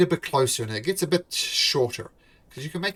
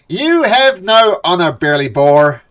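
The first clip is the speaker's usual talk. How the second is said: loud, high, slow accusing yell; 6 semitones higher, 13 dB louder than usual. Acted. Half-elf newcomer yells at the dwarf. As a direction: yell the insult across the dock. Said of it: "the newcomer yells".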